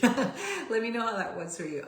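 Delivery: relieved laugh after the exercise. Real, not acted. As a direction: giggle while asking. Laughing after emotional release.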